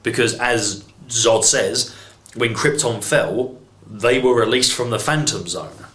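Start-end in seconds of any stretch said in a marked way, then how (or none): none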